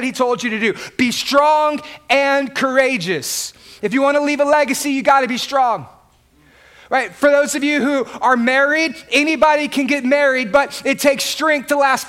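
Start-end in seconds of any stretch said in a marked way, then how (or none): none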